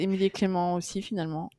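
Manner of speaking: ordinary delivery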